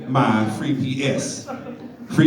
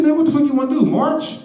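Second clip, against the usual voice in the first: exasperated outburst, pitched much higher; frustrated; 10 semitones higher, faster than usual. Retold or imitated. Fed up with the caller's excuses, exasperated and high. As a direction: throw up your hands and snap it out high. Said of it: "I'm getting frustrated".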